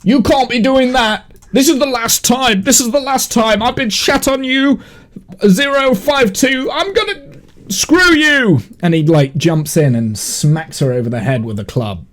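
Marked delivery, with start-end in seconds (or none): 0.0-8.6 angry guard. Acted. Guard NPC angry at her.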